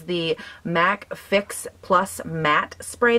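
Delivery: slow, careful naming of the product; said slowly. Shown as a demonstration. Reading a tricky product name to viewers, slow and careful. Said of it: "I have to say that really slow".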